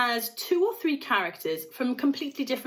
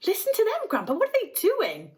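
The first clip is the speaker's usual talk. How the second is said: put-on high, eager boy's cry; excited cry; 10 semitones higher, faster than usual. A narrator's reading. Charlie urging Grandpa to hear the singing, excited high cry. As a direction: call out to Grandpa, thrilled. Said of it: "cried Charlie".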